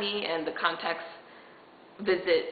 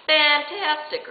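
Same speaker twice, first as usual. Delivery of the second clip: loud, high, drawn-out sarcasm; sarcastic cheer; 9 semitones higher, 6 dB louder, much slower than usual. Real, not acted. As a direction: stretch the word out in mock delight. Sarcastic reaction to refused check.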